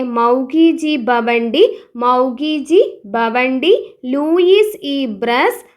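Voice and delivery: very slow, each name spelled out syllable by syllable; slow dictation. Shown as a demonstration. Teacher dictating the chemistry winners, very slow. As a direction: dictate the names slowly for note-taking. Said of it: "ഒന്നുകൂടി പറയാം".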